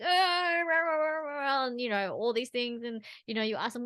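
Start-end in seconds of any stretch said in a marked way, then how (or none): 0.0-1.7 high, loud mock tantrum cry fading down; imitated child wail. Mimicking an upset child crying in the moment, mock wailing. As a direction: ham up a crying toddler's wail. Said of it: "they're like".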